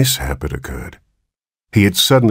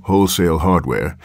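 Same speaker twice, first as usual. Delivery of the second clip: ordinary delivery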